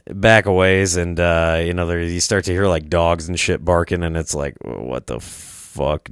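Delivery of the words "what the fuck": slow, low, soft disbelief; hushed bafflement. Retold or imitated. Visitor hearing dogs barking at market, hushed bafflement. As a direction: stretch it out low and softly.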